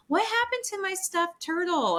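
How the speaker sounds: put-on high, whiny child asking; whiny kid voice. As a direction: play the upset kid, voice up high. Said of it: "they'll be like".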